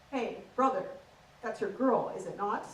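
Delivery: prim received pronunciation, measured; proper posh translation of Essex slang; posh English accent. Acted. Comic translating the Essex lad, in prim posh English. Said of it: "average conversation goes a little bit like this".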